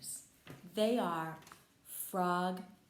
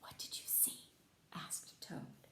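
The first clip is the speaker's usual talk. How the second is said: hushed unvoiced whisper; 14 dB quieter than usual. Toad asking Frog in suspense, whispering. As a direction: lean in and whisper the question.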